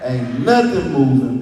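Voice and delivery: ordinary talk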